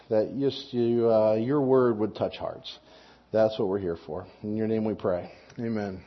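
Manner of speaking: low, soft prayer voice. Acted. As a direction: pray quietly and reverently. Opening prayer.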